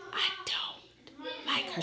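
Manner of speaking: slow conspiratorial whisper; whispered aside. Real, not acted. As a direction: whisper it like a guilty secret. Confessing dislike as an aside.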